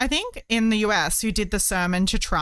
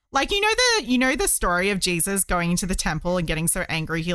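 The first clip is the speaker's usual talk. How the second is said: ordinary talk, nothing marked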